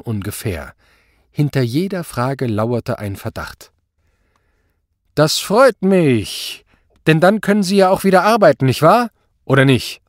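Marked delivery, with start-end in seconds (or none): 5.2-10.0 menacing joviality. A narrator's reading. Superior presses the commissioner to work, menacingly jovial. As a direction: press him with loud tag questions.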